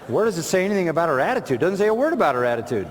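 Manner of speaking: fast, insistent rebuttal; rapid challenge. Real, not acted. Preacher rebutting listeners' claim, fast and insistent. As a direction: rattle it off to knock down the claim.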